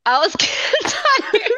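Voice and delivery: real laughter mid-sentence; laughing. Real, not acted. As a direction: giggle out the excuse. Laughing while defending herself.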